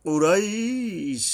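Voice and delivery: কুরাইশ held slow and high, vowel drawn two beats at the stop; long stop. Shown as a demonstration. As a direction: stretch কুরাইশ as a pausal stop. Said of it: "দুই আলিফ টেনে থামতে হবে".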